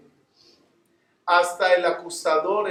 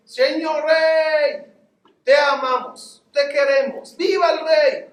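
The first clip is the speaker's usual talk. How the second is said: loud, high, slow cheer from a balcony; shouting tailor; 8 semitones higher, 4 dB louder, slower than usual. Retold or imitated. Tailor on the balcony calling down to the king, loud, high and slow. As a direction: call out to the king, drawn out and high.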